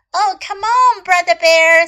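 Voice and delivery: high, loud coaxing; urging sister. A narrator's reading. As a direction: urge your brother along with loud, lively insistence. Sister urges reluctant brother along. (高声催促哥哥)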